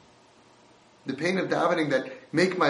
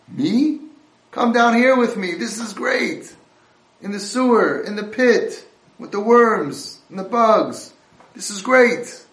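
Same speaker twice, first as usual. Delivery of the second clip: loud, high, slow, gleeful invitation; deluded happy man; 8 semitones higher, 9 dB louder, slower than usual. Retold or imitated. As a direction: sell the filthy pit as paradise. Man in pit invites rescuers.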